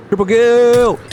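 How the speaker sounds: high, loud game shout; battle cry. Pretend. Gamer charging pillagers with a spear, triumphant shout. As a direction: yell it out like an arcade announcer.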